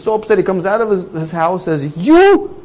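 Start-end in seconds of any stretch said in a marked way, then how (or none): 2.0-2.5 high, loud "You?" of outrage; shocked accusation. Retold or imitated. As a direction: spot the culprit. Confronting the neighbor.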